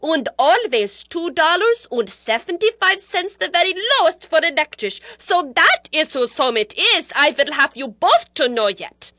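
put-on high, agitated Yiddish-accented tirade; indignant accented old-world mother.

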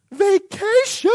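high, slow and drawn-out delighted vacation; excited. Pretend. Youth pastor dreaming of vacation, drawn-out excited. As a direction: savor the word like a dream come true. Imagined own excited reaction.

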